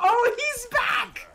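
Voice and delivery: high slow laughing shout; shrieking in delighted alarm; excited shout. Real, not acted. Game monster chases them.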